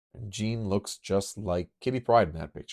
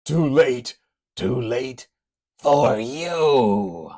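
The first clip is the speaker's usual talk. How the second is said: loud menace; 6 semitones higher, 9 dB louder, much slower than usual. Acted. Lycos menaces.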